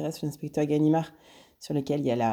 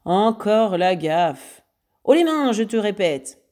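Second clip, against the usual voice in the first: loud slow exchange; 4 semitones higher, 6 dB louder, slower than usual. Acted. Lupin moque, Ganimard répète l'ordre.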